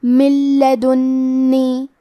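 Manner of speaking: من لدنی with the ن merged into a doubled ل, no nasal hum, very slow; fuse من into لدنی without humming; idgham without ghunna. Shown as a demonstration. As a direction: idgham demo. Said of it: "بغیر غنہ سے لیکن تشدید کے ساتھ".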